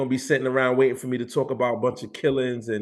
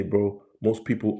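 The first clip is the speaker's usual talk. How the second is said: ordinary talk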